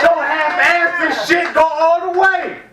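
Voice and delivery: high, loud, slow mock yell; hammed-up shouted scolding. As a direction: bellow it like scolding a dumbass. Mock preacher shouting at atheist.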